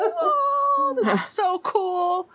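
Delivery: very high, long drawn-out wonder; stretched childlike awe; awestruck kid. Acted. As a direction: marvel slowly like a dazzled child. Game master acts awestruck kids.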